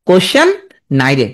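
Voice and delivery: কোশ্চেন lifted, a beat, then নাই রে; mnemonic pronunciation. Shown as a demonstration. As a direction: model the two halves for students. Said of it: "তুমি এইভাবে উচ্চারণ করবা". (থেমে "কোশ্চেন, নাই রে")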